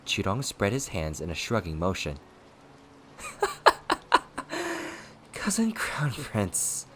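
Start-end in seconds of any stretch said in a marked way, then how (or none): 3.2-5.0 high staccato cackle, tailing into breath; shrill mocking giggle; cocky mad laugh. A narrator's reading. Villain laughing as his foe holds back, mad cackle. 5.3-6.9 slow, airy, high-pitched mockery; mocking address. A narrator's reading. Villain addressing his royal cousin, slow breathy mockery. Villain mocks foe after laughing.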